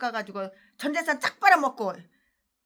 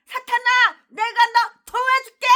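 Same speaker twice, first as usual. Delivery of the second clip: higher, louder fawning offer to Satan; eager helper; 8 semitones higher, 5 dB louder than usual. Acted. Friends offering Satan their help, high eager pledge. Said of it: "계획을 도와줬어".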